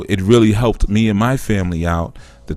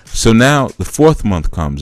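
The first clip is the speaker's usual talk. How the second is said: usual speaking voice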